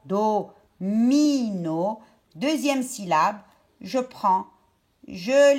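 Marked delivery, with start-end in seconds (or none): 0.1-2.0 "Domino" very slow, long drawn-out "mi"; syllable-by-syllable "Domino"; split into syllables. Shown as a demonstration. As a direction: stretch the middle of "Domino". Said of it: "je prends la deuxième syllabe de".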